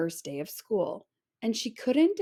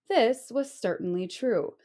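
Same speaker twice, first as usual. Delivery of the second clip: plain reading voice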